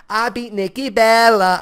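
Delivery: mock southern accent. Wrestling fan mocks Carmella's gloating brag, hammed-up Southern accent. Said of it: "she has a southern accent".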